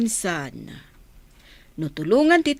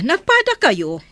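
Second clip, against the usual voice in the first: astonished; 6 semitones higher, 6 dB louder than usual. Acted. Astonished at the resemblance.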